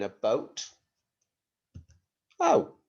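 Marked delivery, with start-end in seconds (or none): 2.4-2.7 surprised oh. Real, not acted. Teacher surprised by something.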